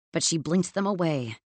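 usual speaking voice

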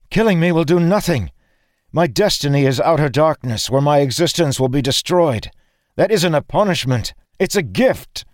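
high, loud, impassioned insistence; strained high voice over a fight; desperate argument. A narrator's reading. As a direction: argue for death with anguished force.

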